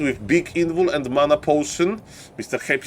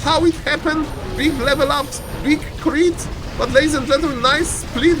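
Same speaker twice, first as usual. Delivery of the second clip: excited commentary; 9 semitones higher than usual. Real, not acted.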